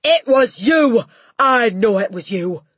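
pin the blame on her with rage; furious accusation. Acted. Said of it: "she roared".

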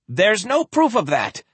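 high, alarmed protest; defensive denial. Acted. Doctor denies the cure rumour.

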